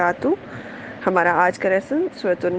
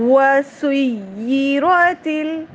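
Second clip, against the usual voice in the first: slow, drawn-out chanted recitation, pitched higher; Quran recitation; 4 semitones higher, much slower than usual. Shown as a demonstration. Teacher opening the lesson with slow chanted recitation. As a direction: recite each word slowly in melodic tajweed.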